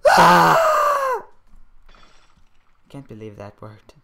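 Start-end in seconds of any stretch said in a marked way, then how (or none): 0.1-1.3 long, piercing high-pitched scream. Real, not acted. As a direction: scream loud at the top of the range. Screams while charging in game.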